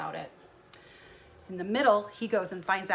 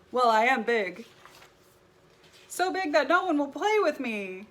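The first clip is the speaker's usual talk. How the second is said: loud, lonely giant voice; big whale explaining he is lonely, loud and lifted; big guy voice; 5 semitones higher, 8 dB louder than usual. Acted.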